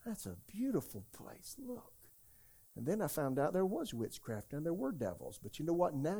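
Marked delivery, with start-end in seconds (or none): 0.0-1.9 high, much softer, charmed voice; softly marvelling newcomer; delighted visitor. Retold or imitated. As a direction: gush over the view quietly. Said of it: "I said".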